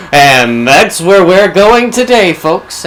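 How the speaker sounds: loud, high, drawn-out host announcement; big slow showman's welcome; rousing show opener. Real, not acted. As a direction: belt it out to kick off the episode. Host launches episode after solemn intro.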